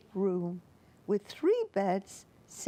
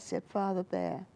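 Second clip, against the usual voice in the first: ordinary delivery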